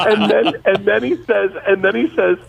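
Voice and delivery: high-pitched, talking through laughter; real laughing. Real, not acted. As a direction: struggle to get the story out while cracking up. Cracking up retelling the joke.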